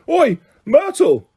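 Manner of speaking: high, slow-stretched call; bellow. A narrator's reading. Peeves summons Myrtle across the room, bellowing. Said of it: "took a deep breath and bellowed".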